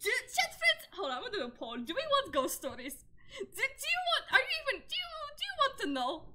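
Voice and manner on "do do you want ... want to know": squeaky playful question; high teasing voice. Real, not acted. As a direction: tease the chat with a high voice.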